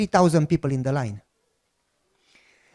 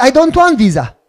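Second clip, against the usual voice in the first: louder, higher protest through laughter; mock protest; 6 semitones higher, 7 dB louder than usual. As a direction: insist loudly he doesn't want it. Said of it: "I said".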